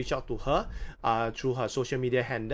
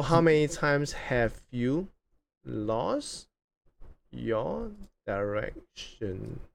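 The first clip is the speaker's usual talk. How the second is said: drawn-out words with long pauses; slow dictation; much slower than usual. Real, not acted. Host rereading question while writing it, slow and halting. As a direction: stretch out the last words as if writing along.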